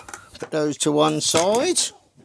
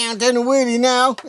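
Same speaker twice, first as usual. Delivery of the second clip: playful excitement; 10 semitones higher, 4 dB louder, faster than usual. Real, not acted. Dad playing with child.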